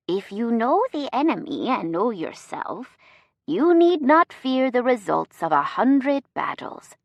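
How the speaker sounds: put-on wise old samurai sage voice; Yoda impression. A narrator's reading. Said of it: "my Yoda impression, mixed with the sage-like tones of a samurai warrior".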